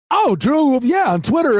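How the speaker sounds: high, delighted oh of recognition; light up as you place the name; sudden recognition. Retold or imitated. Host suddenly recognizes a fan from Twitter, delighted and high.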